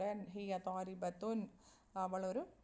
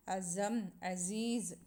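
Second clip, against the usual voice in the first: slow model reading; much slower than usual. Shown as a demonstration. Teacher reads example word slowly.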